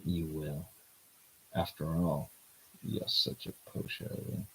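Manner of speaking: lightly voiced cocky guy, low; low male lead voice. Voicing the male lead teasing the heroine, low smooth voice.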